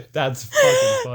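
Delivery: high, slow, through helpless laughter; can barely get it out for laughing; laughing hard. Real, not acted. Cracking up at his friend's story, high and laughing.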